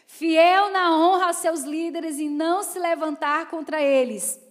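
loud, driving sermon voice; loud preaching. Acted. Preacher pushing on to the next point, loud. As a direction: press on loudly.

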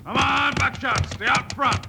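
urging horse on. Acted. Bill urging his horse Buckshot during the race, high and excited. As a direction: push the horse to stay ahead.

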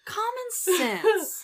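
sarcastic. Acted. Mock obviousness, high drawn out.

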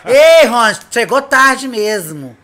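calling out to a late-arriving friend; loud happy greeting. Real, not acted.

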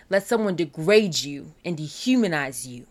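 usual speaking voice

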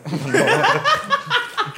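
high laughing burst; burst of laughter. Real, not acted. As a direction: crack up mid-story. Laughs at own robbery story. (carcajada aguda)

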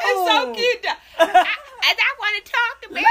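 high, loud laughing; cracking up while talking. Real, not acted. Laughing at mom's lisp.

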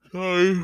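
loud drawn-out yawn into the word sorry; a real yawn breaking through; big yawn. Real, not acted. Tired vlogger yawning mid-confession, loud yawn. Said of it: "didn't mean to yawn".

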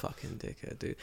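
unvoiced whisper insult; whispered jab at the police; whispered curse. Real, not acted. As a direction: breathe it out, barely there. Agreeing insult, under breath.

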